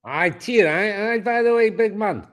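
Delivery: comic Scottish accent, barely words. Shown as a demonstration. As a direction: put on a jokey Scottish accent, climbing in pitch. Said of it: "what's your Scottish accent like".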